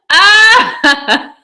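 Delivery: high loud laughing shriek. Real, not acted. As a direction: let out a howl of laughter. Howling at the story.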